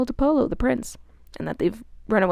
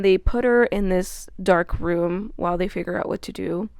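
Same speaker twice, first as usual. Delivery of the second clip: ordinary talk, nothing marked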